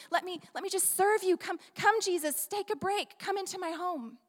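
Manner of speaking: high, rushed, eager welcome; eager Martha. Pretend. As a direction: fuss warmly at the door like an anxious host. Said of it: "I can imagine Martha just rushing to the door".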